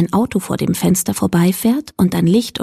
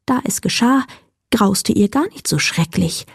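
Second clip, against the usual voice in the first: ordinary delivery, nothing marked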